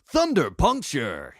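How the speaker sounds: shouted fighting-game attack call; slow, high, loud battle cry; Blue. Shown as a demonstration. Voice actor demonstrating a game fighter's move, shouted battle cry. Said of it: "the voice of the character Blue".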